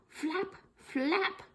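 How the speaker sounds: ordinary talk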